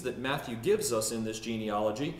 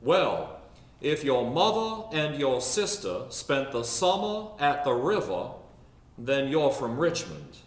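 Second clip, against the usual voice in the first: put-on Richmond Southern accent; 3 dB louder than usual. Native explains Richmond to the preacher, Richmond Southern accent. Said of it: "One native once said to me".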